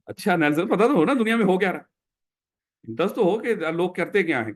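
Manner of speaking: ordinary delivery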